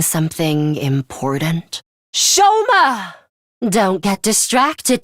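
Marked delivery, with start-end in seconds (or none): 2.1-3.1 sudden high call across the field. Acted. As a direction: call his name out, high and urgent. Calling out a name in battle.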